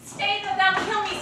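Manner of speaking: desperate loud plea. Acted. Helena calling after the fleeing Demetrius, loud desperate plea. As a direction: call after him as he runs off.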